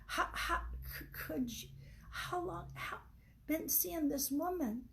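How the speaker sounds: a wife forcing out questions through tears; breaking up mid-question, crying; choppy sobbing speech. Acted. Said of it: "She tried to speak in between sobs".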